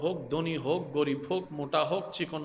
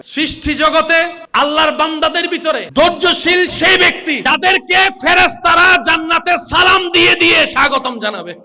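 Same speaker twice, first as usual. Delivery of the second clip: high, loud preaching shout; full-force preaching; 8 semitones higher, 13 dB louder than usual. Acted. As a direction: cry it out to the crowd.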